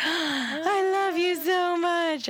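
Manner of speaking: slow, high-pitched mock gushing; drawn-out swoon of gratitude, imitated; gushing adoration. Laboring women to anesthesiologist, gushing mock adoration. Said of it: "the women usually are like".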